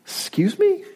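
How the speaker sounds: high-pitched incredulous question; indignant disbelief. Acted. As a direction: squeak it up in affronted disbelief. Acting indignant reply to whispering God.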